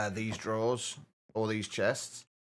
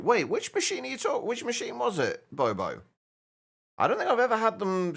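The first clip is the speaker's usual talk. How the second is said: surprised; 11 semitones higher, 3 dB louder than usual. Real, not acted. Surprised question to chat.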